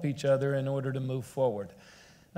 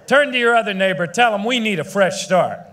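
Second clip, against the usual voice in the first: louder, faster call to the crowd; rally shout; 5 semitones higher, 8 dB louder than usual. Real, not acted. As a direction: whip the crowd up, fast and loud.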